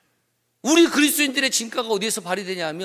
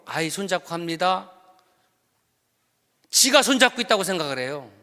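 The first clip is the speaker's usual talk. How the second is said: ordinary talk, nothing marked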